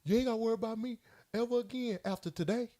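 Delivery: lightly imitating girlfriend; mock girlfriend. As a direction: toss the threat off lightly, high and hushed. Girlfriend's corrected quote.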